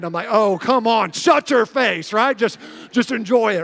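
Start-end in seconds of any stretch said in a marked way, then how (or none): 0.3-2.1 exasperated dad outburst; irritated retort. Retold or imitated. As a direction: tell the kids off in mock outrage. Snapping back at his kids.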